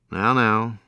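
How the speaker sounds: ordinary delivery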